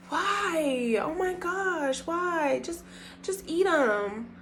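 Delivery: high, slow, drawn-out whine; soft, stretched-out imitated plea; mock pleading. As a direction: imitate someone begging in exasperation. Voicing date pleading with her to eat.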